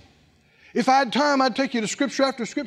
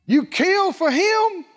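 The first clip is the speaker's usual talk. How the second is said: higher, slow, indignant whine; jealous older son; 12 semitones higher, 4 dB louder, slower than usual. Acted. Older son protesting the feast, high, slow and indignant. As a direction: stretch it out in jealous outrage. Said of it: "the evil voice of unbelief".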